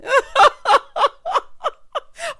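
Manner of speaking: loud, high-pitched belly laugh; hollering laugh. Real, not acted. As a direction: crack up uncontrollably, high and loud. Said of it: "When I tell you I hollered".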